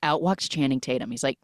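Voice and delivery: normal talking voice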